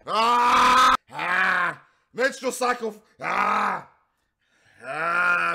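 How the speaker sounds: loud hammed-up screaming; shrieking over and over in mock horror; mock screams. Screaming at daughter scene.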